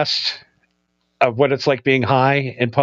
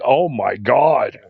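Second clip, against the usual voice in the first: slow stunned awe; 5 semitones lower, much slower than usual. Retold or imitated. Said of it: "you're like".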